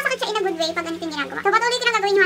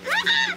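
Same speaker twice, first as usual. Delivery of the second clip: pained cry. Real, not acted. Mask pull pain.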